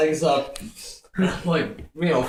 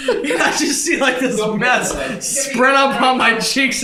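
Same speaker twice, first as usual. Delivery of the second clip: ordinary delivery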